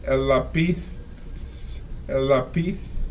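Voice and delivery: lápiz with a lisped z, like "LAH-peeth"; el lápiz with Castilian theta. Shown as a demonstration. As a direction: say lápiz ending in a soft th sound. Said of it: "the way they speak in S-, Spain".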